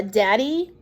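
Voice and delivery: usual speaking voice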